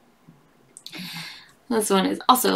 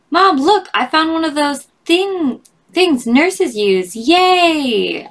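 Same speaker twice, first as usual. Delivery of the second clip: put-on higher, louder eager child; excited kid; 7 semitones higher, 5 dB louder, slower than usual. A child proudly showing Mom a needle, excited. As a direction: show Mom your find, thrilled.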